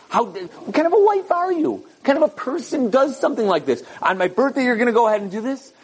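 high-pitched, berating husband act; mock angry yelling. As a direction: play a husband berating his wife, pitch jumping up. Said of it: "He starts yelling at her".

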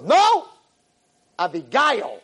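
loud high NO, then the name; retold indignant outburst; emphatic correction. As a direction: shout down the old nickname. Retold girl loudly correcting her name.

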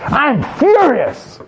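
high, slow, furious outburst. Shown as a demonstration. A man demonstrating a furious part taking over, high slow outburst. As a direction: let the fury take over the line, drawn out. Acting out being taken over.

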